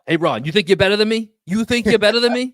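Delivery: high, loud Boston taunt; Boston accent. Pretend. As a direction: mouth off like a Boston kid. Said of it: "in the Boston accent".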